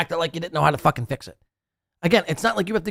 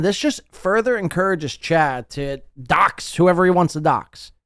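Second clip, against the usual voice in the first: ordinary delivery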